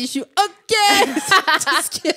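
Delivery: quoting a friend while laughing; laughing imitation. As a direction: giggle through the quote. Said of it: "Comme Jacques, où il t'a dit". (imitation en riant)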